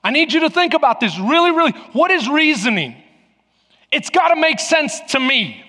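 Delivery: high, forceful pulpit delivery; preaching. Real, not acted. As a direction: drive each point home to the congregation.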